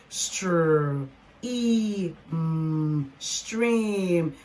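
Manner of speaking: "str", long "e", hummed "mm", then "stream"; blending sounds. Shown as a demonstration. Teacher showing a cluster word to learners, sounded out. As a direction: segment slowly, then stretch "stream". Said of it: "let's blend them together".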